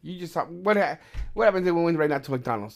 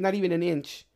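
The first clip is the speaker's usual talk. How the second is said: ordinary talk, nothing marked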